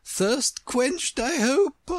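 high, grand Queen's voice; lofty inquiry, last word drawn out; regal Queen. A narrator's reading. Queen asks after Alice's thirst.

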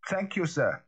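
triumphant. A narrator's reading. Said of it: "a note of something like triumph".

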